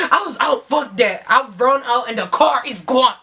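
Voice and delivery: ordinary delivery, nothing marked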